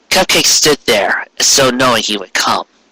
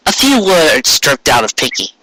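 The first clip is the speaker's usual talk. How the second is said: ordinary delivery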